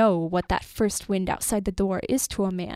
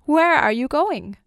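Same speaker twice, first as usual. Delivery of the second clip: raised, higher anxious question; child's cry; 5 semitones higher, 6 dB louder than usual. A narrator's reading. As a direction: cry it out after a departing father. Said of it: "cried one child".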